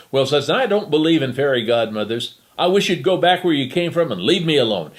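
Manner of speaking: put-on loud, cranky old woman sending him away; grumpy dismissal. Old woman scolds the fake fairy godmother, loud and cranky. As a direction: tell him off sharply.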